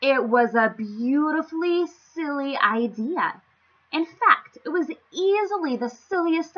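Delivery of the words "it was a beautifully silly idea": ordinary delivery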